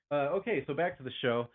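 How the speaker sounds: ordinary delivery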